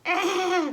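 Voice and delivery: loud high startled yelp; Daddy Pig cry. Acted. Daddy Pig frightened of spiders, loud yelp. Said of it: "Says Daddy Pig".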